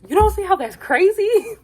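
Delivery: high, loud incredulous squeal through a laugh; mocking Ace. Recapping Ace confronting Nick, squealing disbelief. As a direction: squeal it like you can't believe him. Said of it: "Ace is so funny".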